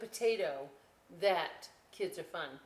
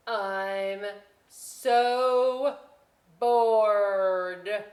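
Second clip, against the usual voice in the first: loud, stretched moan of boredom; loud bored whine; 3 semitones higher, 12 dB louder, much slower than usual.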